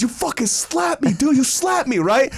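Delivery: high-pitched, disbelieving protest; mock outrage. Slapped friend protests to his attacker, mock outrage. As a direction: imitate an indignant friend.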